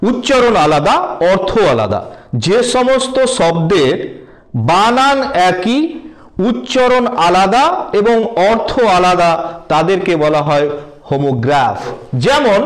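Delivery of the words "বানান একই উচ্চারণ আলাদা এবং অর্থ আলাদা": ordinary talk, nothing marked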